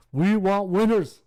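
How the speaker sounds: slow booming coach rant; Singletary impression. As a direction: bark it like the famous presser. Quoting Singletary press conference.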